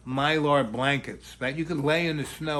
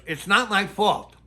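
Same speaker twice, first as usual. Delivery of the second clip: louder, higher retold protest; defensive protest; 5 semitones higher, 5 dB louder than usual. Girl denying blame to the snowplow driver, loudly defensive.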